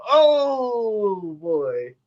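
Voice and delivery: put-on retelling a scare with a knowing "oh boy"; mock dread. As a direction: act out the gang's uh-oh moment. Retold reaction to threat.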